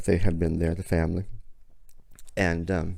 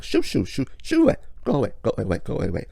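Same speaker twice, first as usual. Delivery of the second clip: shooing; 5 semitones higher, faster than usual. Retold or imitated. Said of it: "starts shooing him away".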